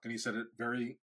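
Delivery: normal talking voice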